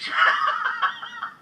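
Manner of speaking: squealing laugh, pitch way up; can't hold the laugh in; high burst of laughter. Real, not acted. Host cracking up after call.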